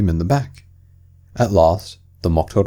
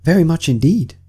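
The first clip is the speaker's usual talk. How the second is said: put-on high polite child voice; Alice keen to see; eager young girl; 9 semitones higher, 3 dB louder than usual. Alice accepting the Mock Turtle's offer.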